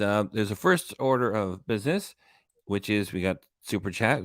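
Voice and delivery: ordinary talk, nothing marked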